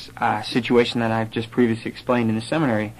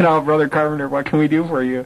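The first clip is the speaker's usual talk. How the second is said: amused missionary; 4 semitones higher than usual. Retold or imitated. Retold missionary on phone.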